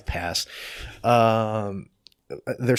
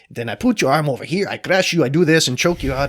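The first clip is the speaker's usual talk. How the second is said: put-on loud, raised Brazilian coach accent; 6 semitones higher, 8 dB louder than usual. Brazilian coach narrating his crushing moves, loud Brazilian accent. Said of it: "I do crappy accents, Brazilians".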